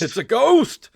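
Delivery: high, loud spooky wail; mock ghost alarm. Host echoes guest's joke about the cat, high mock alarm. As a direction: cry it out in hammed-up fright.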